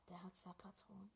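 breathy whisper to the viewers; real hushed aside. Real, not acted. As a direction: whisper it so nobody else hears. Whispering so teammate can't hear.

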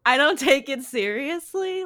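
laughing. Real, not acted. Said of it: "they're ridiculous".

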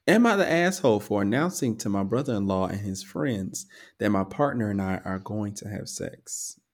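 usual speaking voice

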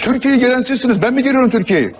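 high, heated retort. Real, not acted. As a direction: argue back with the pitch pushed well up. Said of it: "Bağır, bağır".

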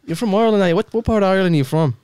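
put-on Irish questioning; mock Irish; Irish accent. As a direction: quiz a countryman in an Irish accent. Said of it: "your Irish accent".

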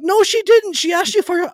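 very high, loud exasperated protest; exasperated shout. Retold or imitated. Son shouting at his companion in the car, exasperated. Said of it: "And I'm like".

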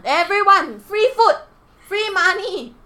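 loud, high hawker's call. Pretend. Mock calling spirits to offerings.

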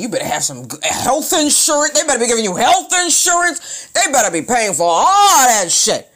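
shouting, high and loud, about health insurance; loud rant. Real, not acted. Ranting at porn actresses in her vlog, loud and high. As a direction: yell it like outraged advice from the couch.

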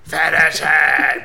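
slow, put-on anime catchphrase; hammed-up anime yell. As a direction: stretch it out like a cartoon hero. Hamming catchphrase bit.

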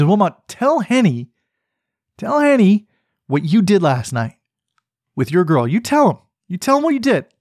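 high, insistent egging on; gleeful prodding; eager urging. Retold or imitated. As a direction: push him to spill the story. Urging Wilmot to tell story.

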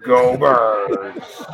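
loud drawn-out rallying shout; team cheer. Real, not acted. Host signing off with his team's slogan, loud cheer. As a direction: cheer your team on, loudly.

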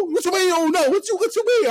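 high, loud mock mother scolding; hammed-up angry mom voice; imitated scolding mom. Mom exasperated.

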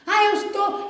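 ordinary delivery

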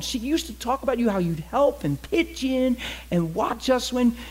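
high, gushing excitement; delighted recognition. Acted. Man recognising his mother's old neighbour, delighted gushing.

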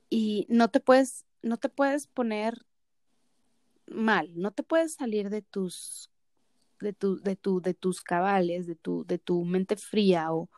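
slow emphasis. Real, not acted. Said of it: "slow emphatic advice".

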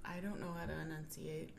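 whisper. Pretend. Mock whisper reply.

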